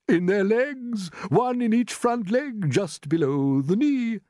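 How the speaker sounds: high, very slow grasshopper; relishing old grasshopper. A narrator's reading. Grasshopper revealing where crickets' ears are, slow relish. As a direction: stretch each phrase out high.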